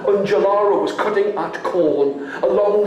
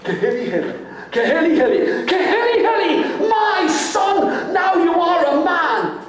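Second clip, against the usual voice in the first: joyful cry; 10 semitones higher than usual. Acted. Mother's joyful cry building.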